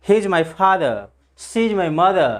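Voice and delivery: "father" and "mother" ending in schwa, no r; silent r. Shown as a demonstration. Teacher giving family examples, "father" and "mother" with silent r. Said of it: "ऐसे बोल सकते हैं".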